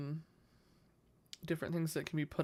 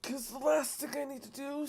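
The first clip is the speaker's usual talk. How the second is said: strained, pitched-up voice mid-stretch; stretching groan; 9 semitones higher than usual. Real, not acted. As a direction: talk while stretching hard. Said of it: "sorry, I'm stretching".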